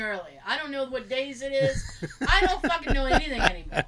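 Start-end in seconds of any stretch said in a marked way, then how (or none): 2.3-3.8 laughing exasperation. Real, not acted. Exasperated about losing track.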